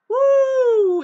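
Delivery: high, drawn-out whoo; loud whoop. Real, not acted. Speaker's own whoop.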